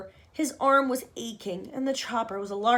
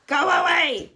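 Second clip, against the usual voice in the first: higher and louder angry cry; cried out; 6 semitones higher, 9 dB louder, slower than usual. Acted. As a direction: drive the boy off with a yell. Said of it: "cried Aunt Sponge".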